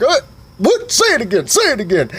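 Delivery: hammed-up high daddy voice; father voice. Dad challenging.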